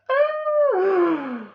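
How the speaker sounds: squealing helpless laugh; high burst of laughter. Real, not acted. Laughing retelling the scene.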